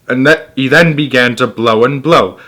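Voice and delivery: ordinary delivery, nothing marked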